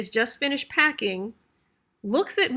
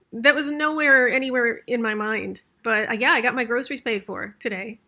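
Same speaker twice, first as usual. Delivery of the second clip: usual speaking voice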